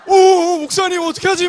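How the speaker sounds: 높고 크게, 과장된 당황; 들켜서 어쩔 줄 모르는 척; 당황한 제자 흉내. Retold or imitated. 클럽에서 들킨 졸업생 흉내. (화들짝 당황한 목소리 흉내)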